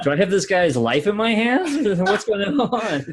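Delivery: high-pitched joking panic; mock alarm. Listener joking he holds a stranger's life, mock alarm. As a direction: play up the worry for a laugh.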